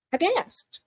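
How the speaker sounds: sharp mock gasp, then I gasped up high; a hammed-up gasp before the words. Shown as a demonstration. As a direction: act out the shock with a gasp.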